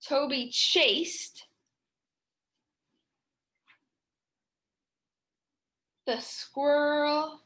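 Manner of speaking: slow, deliberate modeling; slow read aloud. Shown as a demonstration. Teacher rereading her sentence to young writers, slow. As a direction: say each word slowly. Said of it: "I can underline that".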